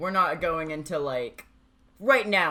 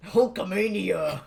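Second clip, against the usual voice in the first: slow wrestler bellow; Hulk Hogan shout; 4 semitones higher, 4 dB louder, slower than usual. Pretend. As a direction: drag it out like a wrestler. Wrestler catchphrase impression.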